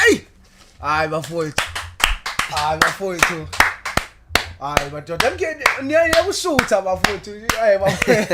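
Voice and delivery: loud overwhelmed exclamations; ecstatic praise shouting. Real, not acted. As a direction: cry out in awe at the poem. Host reacts to poem.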